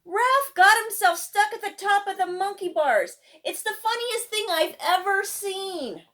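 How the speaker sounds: put-on a kid shouting across the playground, amused; loud, high playground shout; yelling. A narrator's reading. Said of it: "Tony yelled".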